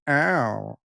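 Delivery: lightly mimicked "Ow"; imitating Sylvia's catchphrase. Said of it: "she goes".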